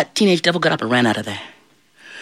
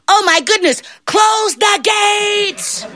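very high shout, last word stretched; alarmed little angel; much slower than usual. Acted. Little angel spots John nearing heaven, alarmed. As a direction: squeal the alarm, drawing out the end.

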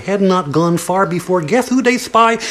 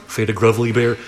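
ordinary delivery

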